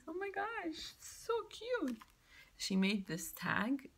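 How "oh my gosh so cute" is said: squealing cute. Real, not acted. Gushing to viewers over little cat stickers, high squeal. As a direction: melt over something adorable, pitched way up.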